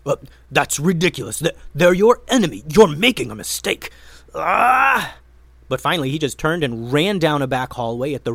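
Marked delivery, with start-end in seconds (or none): 0.0-5.2 loud, nervous wizard voice ending in a high cry; panicked wizard trying to talk trolls down; wizard pleading. A narrator's reading. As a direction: talk fast to save his skin, then yelp. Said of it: "tried to convince the troll".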